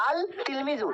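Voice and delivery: আল said with a distinct L before তিলমিজু; আল, then তিলমিজু, no assimilation; unassimilated article. Shown as a demonstration. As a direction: keep the lam audible in আল তিলমিজু. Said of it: "আমাদের হওয়ার কথা ছিল".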